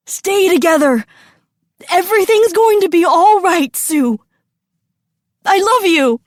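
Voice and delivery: loud, high strained cry; anguished calling. A narrator's reading. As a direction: call desperately to your child. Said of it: "His voice strangled with anguish and smoke".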